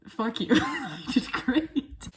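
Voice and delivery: slow, loud laughing I did great; laughing gloat. Real, not acted. Vlogger boasting to viewers, laughing gloat.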